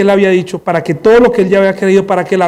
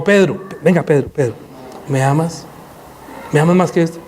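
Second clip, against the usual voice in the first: 1 gentle Jesus; 3 semitones lower, 5 dB quieter than usual. Acted. Risen Jesus questions Peter.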